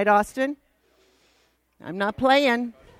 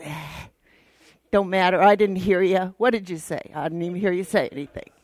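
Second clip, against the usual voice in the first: lower, dismissive grumble, rushing at the end; grumpy husband; 5 semitones lower, faster than usual. Retold or imitated. Husband brushing off his wife's apology, lower dismissive grumble. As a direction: shrug it off like a sulking husband.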